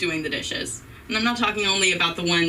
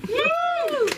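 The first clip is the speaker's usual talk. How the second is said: high self-cheer whoop; mock cheer. Comic whooping for herself to prompt audience applause. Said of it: "Pause for applause".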